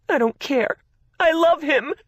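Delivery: high, loud tearful cry; crying harder. A narrator's reading. Sister defending fiancé while crying hard. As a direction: cry it out defiantly. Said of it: "Gracie just cried harder".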